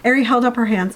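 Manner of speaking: normal talking voice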